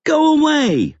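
put-on very high, shrieked little-boy protest; piercing small-child outburst; child's shriek. A narrator's reading. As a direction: shriek it like an angry five-year-old. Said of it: "Ben shrieked".

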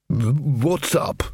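slow, stammering half-awake wizard; lightly voiced dazed bursar; drowsy bursar. A narrator's reading. Bursar just waking up.

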